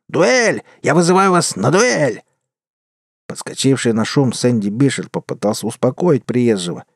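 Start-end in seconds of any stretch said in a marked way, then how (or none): 0.1-2.2 shouted challenge. A narrator's reading. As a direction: yell the challenge to the whole saloon. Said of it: "заорал на весь салон".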